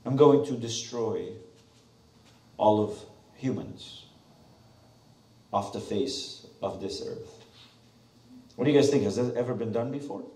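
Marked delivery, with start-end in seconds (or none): 0.1-7.4 slow, low, solemn divine pronouncement; measured, low voice of God; God's voice. Acted. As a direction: speak as God declaring judgment, slowly. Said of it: "God says".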